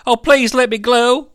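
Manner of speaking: put-on high little-boy plea; pleading child. Glenn begging the nurse to let him go, pleading. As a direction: beg as the young son.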